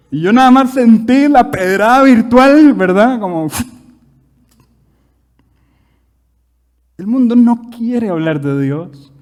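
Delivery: loud, high, slow hammering preaching; full-force preaching. Acted. Preacher declares the world refuses God, slow loud preaching. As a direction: pound each repeated phrase at the crowd.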